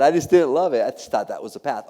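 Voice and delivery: ordinary delivery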